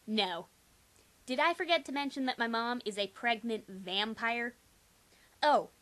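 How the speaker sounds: usual speaking voice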